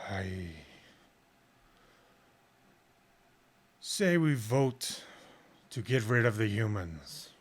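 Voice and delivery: slow, booming dragon decree; the gold dragon proposing a vote. Acted.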